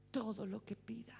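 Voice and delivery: hushed whispered echo. Shown as a demonstration. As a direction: breathe it out as a secret. Repeats promise, then whispers it.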